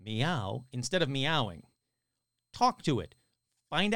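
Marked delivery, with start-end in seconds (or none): 0.0-0.6 "meow" said slowly as an ordinary word; no cat sound, just the word "meow"; spoken human meow. Retold or imitated. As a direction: enunciate "meow" plainly, both syllables clear. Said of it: "carefully enunciating the word meow".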